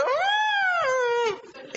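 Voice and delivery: very high strangled cat squeal; mock cat cry, piercingly high; imitated cat squeal. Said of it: "el gato chilló y hizo".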